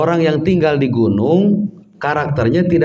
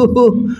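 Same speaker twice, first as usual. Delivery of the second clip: laugh; 10 semitones higher than usual. Real, not acted. Laughs at own impression.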